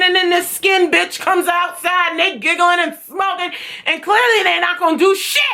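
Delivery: high-pitched, worked-up complaint; exasperated rant. Real, not acted. Student ranting about giggling, smoking staff, high and exasperated. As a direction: pile up the grievance in a high, indignant voice.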